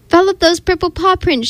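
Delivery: understated storybook shout; mild shout. A narrator's reading. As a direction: call out urgently without real volume. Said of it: "shouted Daddy".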